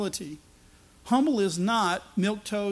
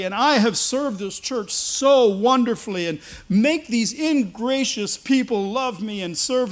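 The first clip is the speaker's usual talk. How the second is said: louder and slow, hammed-up pious boasting; mocking self-righteous prayer; 2 semitones higher, 7 dB louder, slower than usual. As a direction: parody a showy prayer for the crowd. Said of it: "the prayers of the Pharisees".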